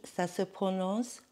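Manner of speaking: usual speaking voice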